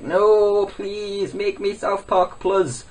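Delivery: high mock tantrum; drawn-out pleading in a put-on voice; PewDiePie. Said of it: "he starts taking a tantrum".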